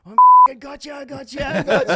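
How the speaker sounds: high, loud laughing taunt; imitating his yell. Corey Brown yelling at his close mate while racing past, loud laughing taunt. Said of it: "I could hear his call, like his yell".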